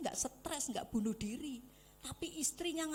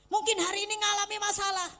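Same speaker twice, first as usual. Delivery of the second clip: much higher and louder burst; preaching outburst; 9 semitones higher, 10 dB louder, faster than usual. Real, not acted. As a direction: throw it out to the hall. Sudden burst while preaching.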